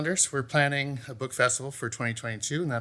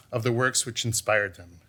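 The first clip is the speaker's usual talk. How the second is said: ordinary talk, nothing marked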